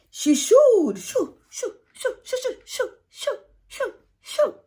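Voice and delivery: high, squealing shoo shoo to chase animals; demonstrated shooing, high and crying; shooing noises. Shown as a demonstration. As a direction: shoo them out in a high cry. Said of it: "So you have to demonstrate".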